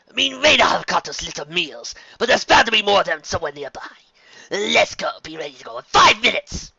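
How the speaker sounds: loud, high hunter's voice; rally the pack for the hunt; villain eager to be on the hunt. Acted.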